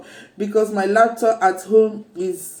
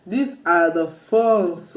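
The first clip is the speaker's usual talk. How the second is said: ordinary delivery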